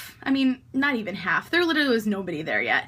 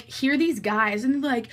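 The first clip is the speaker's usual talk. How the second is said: ordinary talk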